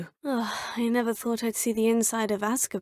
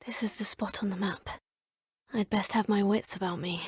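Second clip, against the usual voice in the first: soft hushed whisper, half to yourself; quiet whispered aside; whisper to self; 3 semitones lower, 6 dB quieter than usual. Acted. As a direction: breathe it out as you check the map. Exploring alone, muttering to self.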